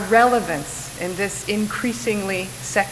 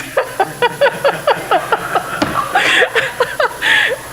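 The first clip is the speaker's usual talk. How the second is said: high, helpless laughter; big laugh; 4 dB louder than usual. Real, not acted. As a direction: burst out laughing at the memory. Laughing at her own story.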